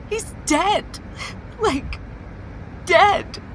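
hiccupping sobs. Acted. Reporting friend's death, upset.

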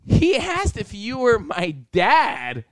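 drawn-out, gleeful teasing imitation; teasing friend. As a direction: drag each word out, mocking him. Acts out Jordan teasing him.

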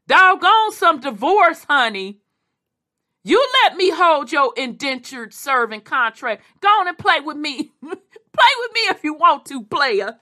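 high, loud mock-pleading husband. Husband begging the wife who bought him, high loud mock plea. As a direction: play the husband begging his wife, half laughing.

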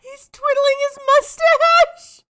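high, slow and loud through laughter; laughing. Real, not acted. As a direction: squeal it out while laughing. Laughing hard at the poster.

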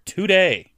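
"today" drawn out with a falling tune; stress on today. Real, not acted. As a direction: lean hard on "today". Hammed-up emphasis, then echoed.

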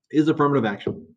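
ordinary talk

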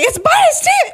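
very high squeal of alarm; retold panic. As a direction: squeal it as if the bug bites.

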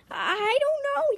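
very high, stammered reply; flustered protest. Acted. Jordan answering an angry accusation, high flustered protest. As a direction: squeal it defensively with a stutter.

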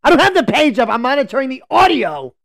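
high, loud, fed-up yelling; exasperated outburst. Real, not acted. Host scolding co-host for interrupting the sign-off, exasperated yell. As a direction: snap back loudly at being interrupted.

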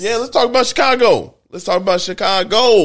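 loud, high, excited push to change topic. Real, not acted. Host loudly pushing the Chicago topic, yelling. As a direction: shout it out over your co-host. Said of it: "Why are you yelling at me right now?".

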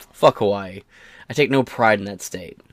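ordinary talk

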